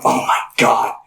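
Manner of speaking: whispered. Retold or imitated. Inner panic in retold story.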